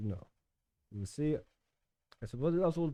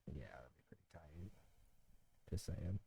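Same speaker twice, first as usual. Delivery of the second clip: breathy under-the-breath remark; whispered aside; 7 semitones lower, 17 dB quieter than usual. Real, not acted. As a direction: whisper it to the side.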